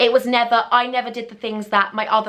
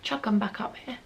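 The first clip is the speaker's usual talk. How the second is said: soft unvoiced whisper; telling boyfriend where the boobs are, whispered; 12 dB quieter than usual. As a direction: whisper it sheepishly. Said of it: "I was like".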